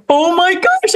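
put-on high amazed kid voice; excited little boy. Boy finding clay on the tables, high amazed gasp. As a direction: gasp like a kid who just saw clay. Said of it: "and he's like".

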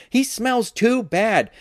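high, drawn-out complaint; crying out in protest. A narrator's reading. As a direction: object like a kid, slow and high. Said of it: "Josh cried".

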